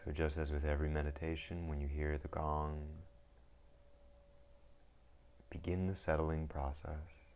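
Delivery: calm, unhurried meditation guide with long pauses; guided meditation. Acted. Teacher guiding listeners into settling the mind, calm meditation voice. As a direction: ease them into stillness, one phrase at a time.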